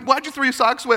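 high-pitched mock scolding; exasperated parent. Parent questioning a child about socks, high exasperation. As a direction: demand an answer like a frazzled parent.

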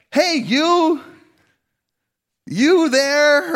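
high, loud mock hail to a nameless passer-by; hammed-up awkward hello; awkward stranger greeting. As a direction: call out clumsily to someone whose name you don't know. Awkward conversation of, like.

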